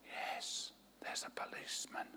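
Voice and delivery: tiny whispered yes. A narrator's reading. Hiding child whispering to the caller. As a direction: barely breathe the word.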